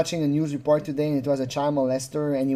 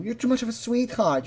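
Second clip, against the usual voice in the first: mock avuncular charm; 7 semitones higher than usual. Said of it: "acts like... Santa Claus".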